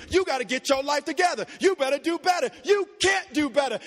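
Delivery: fired-up preacher in full flow; impassioned preaching. Real, not acted. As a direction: hammer out the rebukes with fervor. Impassioned rebuke of condemning preaching.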